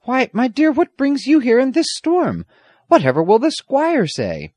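high, loud amazed questioning; astonished. A narrator's reading. James questioning the girl in the storm, astonished. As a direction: pitch it up in disbelief. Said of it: "exclaimed in astonishment".

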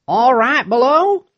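high, loud questioning call. A narrator's reading. Rescuer to miners at the bottom of the shaft, calling down. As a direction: call out high to someone far below.

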